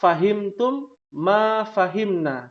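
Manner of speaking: slow, careful "Fahimtum ma fahimna."; slow classroom model phrase; slow Arabic example. Shown as a demonstration. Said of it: "Ana sampaikan".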